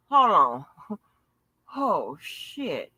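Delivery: ordinary talk, nothing marked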